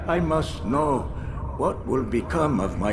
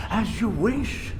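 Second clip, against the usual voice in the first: mock indulgent father; 9 semitones higher than usual. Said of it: "What would you say?".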